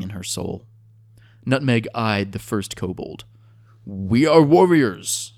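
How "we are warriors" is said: slow, loud, higher lizard-tongue speech; pidgin reptoid. A narrator's reading. Nutmeg addressing the kobold in its tongue, slow and loud. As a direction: speak slowly as if to foreigners. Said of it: "said Nutmeg in pidgin reptoid".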